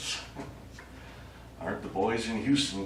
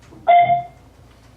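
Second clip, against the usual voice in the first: loud, high delighted burst of laughter; a sudden triumphant laugh to himself; sharp shrieking laugh; gleeful laugh; 15 dB louder than usual. Acted. Alien alone after Houston signs off.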